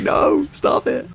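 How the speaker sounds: slow, very high mock-pained whine; pained pleading. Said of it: "it was a little tiny cut".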